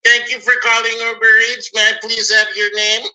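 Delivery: customer service voice. Retold or imitated. Retelling her call, mimicking the Uber Eats agent's greeting. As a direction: put on the cheery phone agent. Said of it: "So I call customer service".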